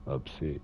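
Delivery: "Upset" said as up-sit, ending in a short i; spelling read aloud. Shown as a demonstration. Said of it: "I had to say it like that".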